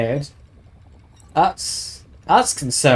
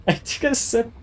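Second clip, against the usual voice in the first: laughing; 9 semitones higher than usual. Real, not acted. Laughing while admitting a sip.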